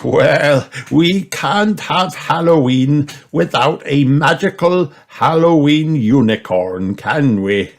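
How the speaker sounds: amused grandpa. A narrator's reading. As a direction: go along with it good-humouredly. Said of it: "laughed Grandpa Pig".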